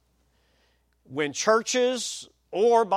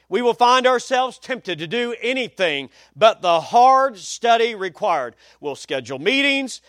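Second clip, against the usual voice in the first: punchy, loud pulpit list; forceful quote reading; 5 semitones higher, 5 dB louder than usual. Real, not acted. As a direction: hammer each item of the list.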